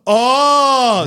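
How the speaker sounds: sharp gasp then a high, loud oh; surprised gasp. Retold or imitated. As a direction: gasp of sudden realization. Retold moment of realization.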